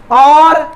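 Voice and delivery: Orthography with long pauses between ऑर, थो, ग्रा, फी; split into syllables. Shown as a demonstration. Teacher asking students to pronounce it, split into syllables. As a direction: punch out orthography loudly, one beat at a time. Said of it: "अब इसका उच्चारण कीजिए".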